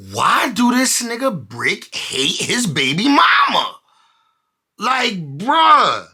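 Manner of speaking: can't believe what he just read; incredulous outburst. Real, not acted. Incredulous reaction to the threats.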